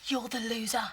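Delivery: low, soft, fast spite; spiteful whisper. Acted. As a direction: hiss it quietly. Hushed quarrel.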